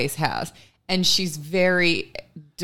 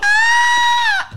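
imitated very high, piercing squeal; loud toddler squeal; 8 dB louder than usual. Mom imitating her toddler on the potty, loud high squeal. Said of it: "She was squealing so loud".

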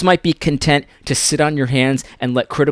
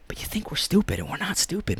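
whisper; 3 semitones higher, 12 dB quieter than usual. Real, not acted. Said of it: "quiet unvoiced aside".

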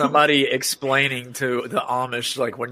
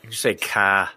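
Boston accent; 6 semitones lower, slower than usual. Shown as a demonstration. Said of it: "work on my Boston accent".

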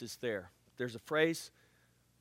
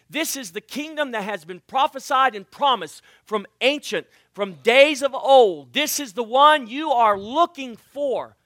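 loud, rising preacher's build; preaching at full force; 5 semitones higher, 10 dB louder than usual. Acted. Preacher booming to the church, fervent. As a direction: proclaim it to the congregation.

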